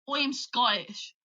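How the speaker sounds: slow, put-on Scottish accent; stretched-out Scottish accent. Shown as a demonstration. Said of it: "like, Scottish".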